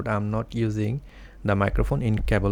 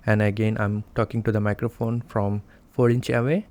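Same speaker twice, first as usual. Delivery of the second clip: ordinary talk, nothing marked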